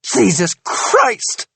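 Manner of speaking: high, slow angry outburst; slow furious exclamation; bristling rage. Acted. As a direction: drag it out in rage. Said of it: "bristling with rage".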